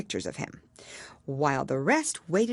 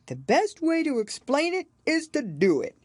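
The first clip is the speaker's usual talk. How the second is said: dodo explaining; 11 semitones higher, 4 dB louder than usual. A narrator's reading. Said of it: "said the dodo".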